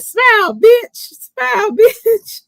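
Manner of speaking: high, loud, slow shout; quoted shout. Retold or imitated. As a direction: belt out the quote, laughing at the end. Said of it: "Then he said".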